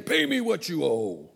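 acted angry demand in a strained voice; forceful, menacing creditor voice; throttling demand. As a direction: demand it with hands round his throat. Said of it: "took him by the throat".

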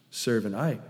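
normal talking voice

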